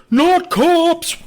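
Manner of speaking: high, loud cartoon yelp; hammed-up shriek of dread; mock panic. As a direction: yelp it in exaggerated alarm. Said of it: "he's just like".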